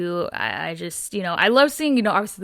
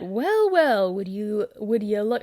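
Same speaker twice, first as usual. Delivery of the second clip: attempted Butcher accent; slower than usual. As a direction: try Butcher's British accent, then falter. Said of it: "just imagine this being read in, like, Butcher's accent".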